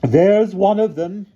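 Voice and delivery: put-on high slow loud old woman; cried. A narrator's reading. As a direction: point and cry out. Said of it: "cried Miss Scrimmage".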